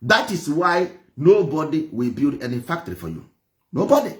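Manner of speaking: ordinary talk, nothing marked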